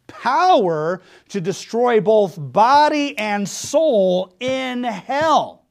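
slow, heavy emphasis on power; drawn-out preaching emphasis; stressed. Acted. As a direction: lean hard on each word. Preacher stressing key phrase.

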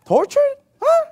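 high, startled echo of the word; shocked question. Real, not acted. Preacher reacting to the verse with shock. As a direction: repeat it in disbelief.

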